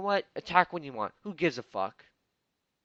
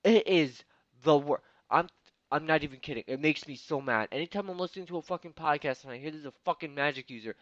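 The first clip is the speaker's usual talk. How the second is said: ordinary talk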